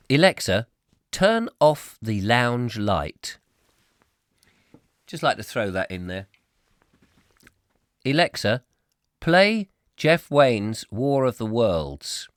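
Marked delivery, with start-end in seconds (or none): 0.1-3.4 slow, deliberate pretend command. Host prankishly orders listeners' smart speakers about, slow and loud. 8.0-12.3 slow, loud pretend command. Host orders Alexa to play music, slowly and loudly. Mock command to smart speaker.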